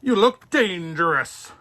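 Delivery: policeman. Acted. Policeman warns.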